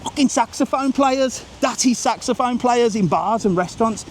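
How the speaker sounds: high-pitched exasperated tirade; indignant, pitch pushed right up; worked-up rant. Real, not acted. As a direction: rant as if you've completely lost patience. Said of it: "Once I, once I go, I go".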